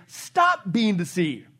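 "Stop" hit hard, whole phrase slow and deliberate; emphatic slow quote. Shown as a demonstration. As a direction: drag out "Stop being deceived" word by word. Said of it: "literally in the Greek".

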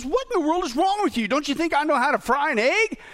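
high-pitched, mock-outraged retort; mildly hammed-up indignation; outraged wife. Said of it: "she's completely outraged".

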